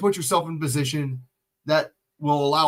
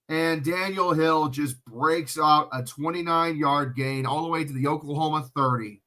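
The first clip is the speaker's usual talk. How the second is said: normal talking voice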